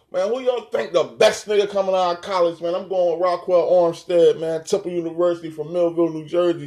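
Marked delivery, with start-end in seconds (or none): none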